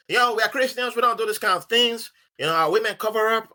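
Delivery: high-pitched indignant objection; acted protest. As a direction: protest in mock outrage, pitched well up.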